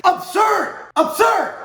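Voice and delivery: real fury, shouted high and loud; angry shout. Real, not acted. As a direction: blow up and yell it out in outrage. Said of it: "that's why I'm so angry".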